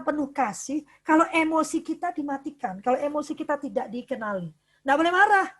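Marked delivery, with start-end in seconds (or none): 4.8-5.5 louder scolding, higher; mock strict parent scolding a child. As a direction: forbid it firmly like a strict parent.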